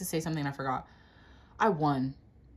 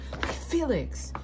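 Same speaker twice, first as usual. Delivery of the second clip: ordinary talk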